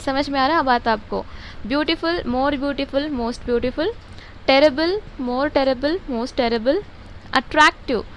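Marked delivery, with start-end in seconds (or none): none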